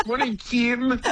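Kim said high, through laughter; New Zealand vowels. Shown as a demonstration. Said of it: "I wanna hear you say my name".

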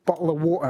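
"bottle of water" with both Ts dropped; Cockney glottal stops in "bottle" and "water". Shown as a demonstration. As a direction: say "bottle of water" as bo'le of wa'er. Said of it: "you get to drop letters".

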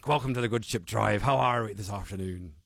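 normal talking voice

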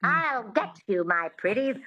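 twangy witch voice; Wicked Witch. Shown as a demonstration. Coach showing why twang matters, Wicked Witch voice. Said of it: "Think of the Wicked Witch".

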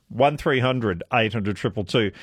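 normal talking voice

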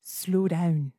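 slow down in a mock Scottish accent; imitated Scottish accent. Host echoing the Scottish caller, imitated Scottish accent. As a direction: echo the words with Scottish vowels.

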